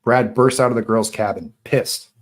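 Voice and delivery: usual speaking voice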